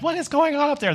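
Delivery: high-pitched puzzled question from the back seat; puzzled call. Retold or imitated. Kid in the back seat calls to mom and brother, baffled. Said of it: "I'm like".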